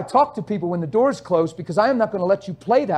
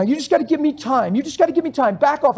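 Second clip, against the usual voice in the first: usual speaking voice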